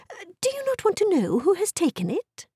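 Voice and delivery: high-pitched impatient wife. Acted. Wife pressing her indifferent husband for interest, impatient. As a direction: burst out impatiently, pitched well up. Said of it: "cried his wife impatiently".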